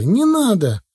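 high pleading shout; boy's cry. Acted. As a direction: beg loudly in a child's high voice. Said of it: "вдруг закричал мальчишка". (мальчишка кричит с мольбой)

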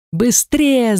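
high, urgent hurry-up call; shout. A narrator's reading. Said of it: "закричал нам Фил".

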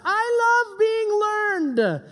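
high, slow goofy voice; sarcastic over-the-top "I love learning" voice; mock eager student. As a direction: ham up a fake keen pupil. Mocking reluctant student voice.

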